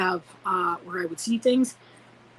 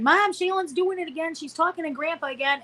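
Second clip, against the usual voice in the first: put-on high whiny kid calling for Mom; mock tearful tattling; 9 semitones higher than usual. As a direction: imitate an upset child telling on a sibling. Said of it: "they'd be crying".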